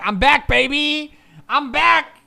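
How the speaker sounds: high, triumphant mock boast; Hamilton. Streamer imitating a driver after good races, exultant. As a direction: crow it like a comeback announcement. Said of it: "Hamilton's like".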